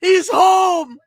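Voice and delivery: high, loud, cracking shouts; ecstatic screaming. Real, not acted. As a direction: scream with emotional joy. Fan overjoyed about pick.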